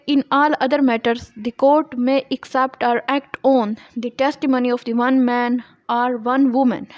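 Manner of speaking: reading law text slowly and deliberately; measured dictation pace for students; slow statute reading. Acted. Reads statute text aloud. (धीमे-धीमे क़ानून की इबारत पढ़ना)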